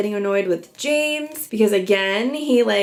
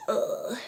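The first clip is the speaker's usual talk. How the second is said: disgusted groan; 8 dB quieter than usual. Real, not acted. Host searching for a word for his lying, disgusted groan. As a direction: let out a quiet, disgusted groan.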